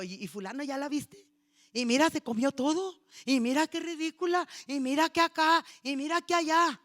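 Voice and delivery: loud, catty gossip; gossiping churchgoer. Retold or imitated. Churchgoers gossiping about a woman on the way out. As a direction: dish the dirt with relish.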